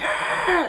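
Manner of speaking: high piercing wordless "Ah!"; imitated scream. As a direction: screech like the attackers did. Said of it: "just hollering, screaming at me".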